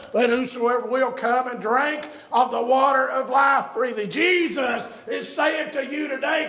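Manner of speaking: loud, ringing preacher's cry; full-force preaching. Acted. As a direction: preach it out to the whole church. Altar call preaching.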